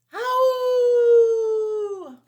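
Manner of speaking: huge high howling shout; very loud howl. Shown as a demonstration. Teacher shows the kids a very loud howl. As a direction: let out a full loud coyote howl. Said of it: "We know what very loud is".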